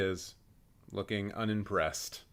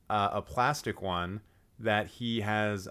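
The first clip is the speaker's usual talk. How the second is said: usual speaking voice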